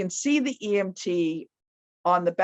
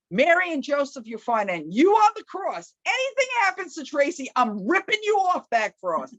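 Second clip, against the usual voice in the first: high-pitched yelled threat to the statue; retold chapel yell, raised pitch; yelling at the cross; 8 semitones higher than usual. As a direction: rage at the saints as if they can hear. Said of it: "at the top of my lung yelling in the chapel".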